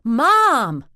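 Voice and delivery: loud, high bellow up the stairs; bellowed call. A narrator's reading. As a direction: yell it from upstairs at full volume. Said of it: "Grace bellowed".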